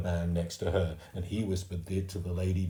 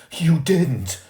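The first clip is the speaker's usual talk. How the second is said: higher, louder shocked denial; aghast mother; 10 semitones higher, 12 dB louder than usual. A narrator's reading. Mum refusing to believe Fudge ate the turtle, shocked. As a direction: disbelief rising to alarm.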